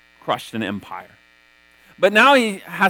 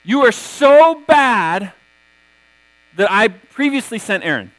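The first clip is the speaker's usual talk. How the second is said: mock exasperated God; 3 semitones higher, 4 dB louder than usual. God scolds Moses in retelling.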